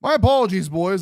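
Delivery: high, loud overdone apology to the chat; mock formal apology. Streamer apologising to his viewers for the outage, mock formal. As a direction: play it like a grand announcement.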